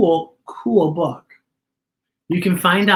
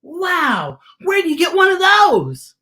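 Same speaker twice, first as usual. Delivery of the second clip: put-on high, loud, amazed shout of a child; a kid calling out, amazed at the treehouse; amazed exclaim; 14 semitones higher, 5 dB louder, slower than usual. A narrator's reading. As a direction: shout out in wonder. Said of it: "Peyton exclaims".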